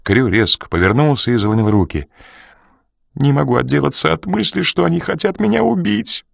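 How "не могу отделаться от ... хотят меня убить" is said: high, moaning complaint. Acted. Crew moaning his fear to Coridon. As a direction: moan out your fear. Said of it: "простонал он".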